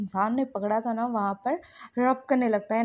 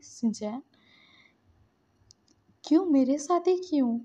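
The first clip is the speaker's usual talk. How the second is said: upset, pitched-up plea, mildly tearful; 6 semitones higher, 3 dB louder than usual. A narrator's reading. Lanson tells Shinchan he felt dirty, acted tearful distress. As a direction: voice rising louder in narrated distress. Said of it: "बोल के ना वो रो रहा था".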